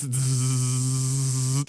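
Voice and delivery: long droning zzz of a buzzer; imitated buzz. Teller imitating the gym scoreclock's constant noise, droning buzz. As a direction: drone a steady electrical buzz. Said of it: "all you can hear in the background is".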